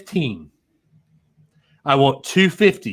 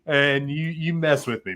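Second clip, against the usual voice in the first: normal talking voice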